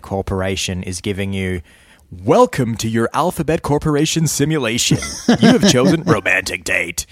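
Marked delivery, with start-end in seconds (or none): 2.1-7.1 higher, louder system announcement; mock robotic announcer. Imagined Alphabet simulation greeting the user, announcer voice. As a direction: read it like a cheery machine.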